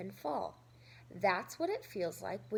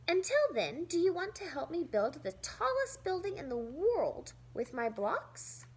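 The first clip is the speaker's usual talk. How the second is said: cheerful invitation; 6 semitones higher than usual. Acted. Felix cheers up sister.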